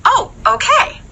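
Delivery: high, light "oh okay" shrugging it off; breezy cake lady. Shown as a demonstration. Cake lady accepting refusal.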